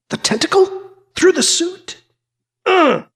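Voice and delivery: high-pitched, startled questioning; rising alarm ending in a grunt; alarmed disbelief. A narrator's reading. Hero feels tentacle through the suit, acted alarm. Hero's alarmed thought in story.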